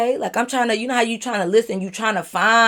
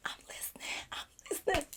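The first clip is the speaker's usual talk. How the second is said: breathy whispered reply, barely voiced; 9 semitones higher, 21 dB quieter, much faster than usual. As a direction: whisper it like you don't want to interrupt. Whispered reply while he raps.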